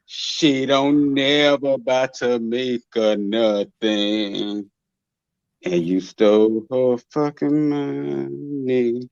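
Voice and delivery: very slow, low, languid drawl. Acted. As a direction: stretch every word out, low and lazy. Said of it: "do it in my [name] voice".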